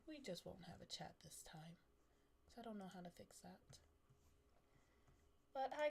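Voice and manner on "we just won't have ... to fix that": whisper. Real, not acted. Muttering to self about chat.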